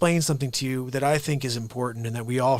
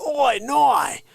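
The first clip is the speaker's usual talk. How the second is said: mock Australian accent, higher and louder; Steve Irwin Australian accent; 10 semitones higher, 6 dB louder than usual. Host teasing Carrie with a higher, louder mock Australian accent. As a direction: ham up the Australian twang. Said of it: "He'd be like".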